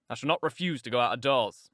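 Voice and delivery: low-pitched, firm man's voice; brooding man. Acted. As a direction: answer low and resolute. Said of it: "he answered".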